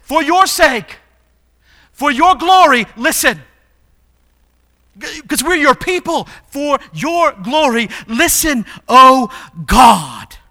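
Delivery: high, loud preacher's cry to God; impassioned plea. Acted. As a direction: plead boldly, building to a shout.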